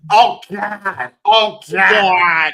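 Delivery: loud mock cries of Oh God; hammed-up bedroom cries; a woman's exaggerated moans; mock moaning. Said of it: "made love to a woman from Wisconsin".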